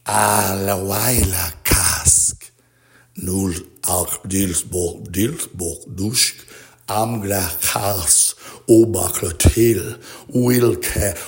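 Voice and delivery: slow, drawn-out chanted names; slow incantation. Acted. Ritual chanting of strange names, slow and solemn. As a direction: intone each word like a ritual.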